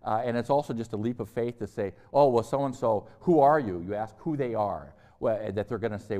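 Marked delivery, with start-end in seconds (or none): 2.1-3.8 loud questioning call; mock calling out. Lecturer voicing someone asking identity, louder.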